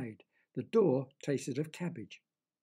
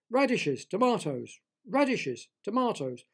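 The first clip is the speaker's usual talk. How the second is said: higher, louder one-word retorts; annoyed bickering; 6 semitones higher, 5 dB louder than usual. A narrator's reading. Deborah and Derek argue over the taste, annoyed. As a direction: squabble back and forth, each word insisted. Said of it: "getting annoyed with Derek".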